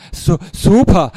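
loud, slow, stammered cheer; stuttering, excited gasp; breathless delight. A narrator's reading. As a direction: call it out thrilled and winded. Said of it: "rief Axel mit leuchtenden Augen".

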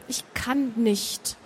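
slow, soft near-whisper. A narrator's reading. Grona admitting she cannot leave, in a soft near-whisper. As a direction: drop to a soft, slow murmur. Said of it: "flüsterte sie".

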